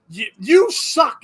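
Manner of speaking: imitating online haters; mock insult. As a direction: sneer it out slowly like a troll. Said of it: "everybody's like".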